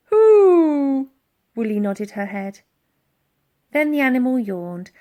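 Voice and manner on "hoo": high, loud trumpeting hoot; mammoth hoot. A narrator's reading. The mammoth Woolly answering Elsie, loud hoot. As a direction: let out a big animal hoot.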